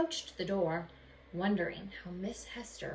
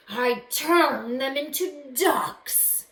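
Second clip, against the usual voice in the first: loud, slow, high bellow; 6 semitones higher, 11 dB louder, much slower than usual. Acted. Magician bellowing at the horrified boy, loud and slow. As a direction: boom it out with glee. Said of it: "bellowed Gazazi".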